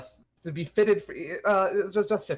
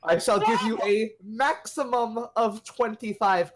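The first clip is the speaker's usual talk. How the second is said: lightly acted rich patron, higher pitch; a hint of a haughty rich man; 8 semitones higher than usual. Rich guy grants Leon a small loan, lightly haughty.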